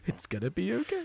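tiny, high, soft voice rising at the end; freaked-out reassurance to yourself, very quiet; frightened self-soothing. Acted. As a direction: whimper it curled up in a ball. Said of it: "He's like".

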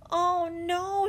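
high, drawn-out dismay; dismayed exclamation. A narrator's reading. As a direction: exclaim in alarm at a bad sight. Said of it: "she exclaimed".

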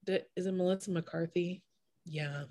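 soft, hushed aside; whisper voice. Real, not acted. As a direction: drop to a whisper. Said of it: "I like that you did a whisper voice".

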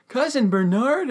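high-pitched astonished question; acted incredulous query; delighted disbelief. Eugene amazed they are cousins.

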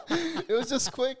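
laughing. Real, not acted. Laughing while recalling gum sales.

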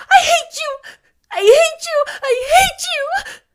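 shrieked, very high and loud; choked angry cry. A narrator's reading. Anne rages at Missus Rachel, choked shouting. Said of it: "she cried in a choked voice".

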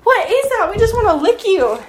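high-pitched imitation of eager dogs; excited dogs. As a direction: voice curious dogs in a high squeal. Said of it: "them just being like".

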